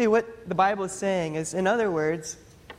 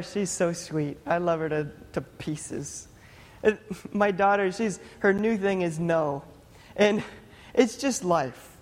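ordinary talk, nothing marked

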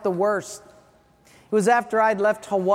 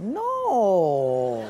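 feigning innocence; mock-innocent single no; 2 semitones lower than usual. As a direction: play the little lamb, all innocence. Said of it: "as innocently as Mary's little lamb".

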